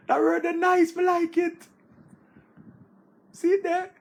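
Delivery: high, excited praise of the word; gushing over a fancy word; delighted. Real, not acted. Loves the big word he just read, gushing.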